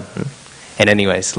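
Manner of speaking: usual speaking voice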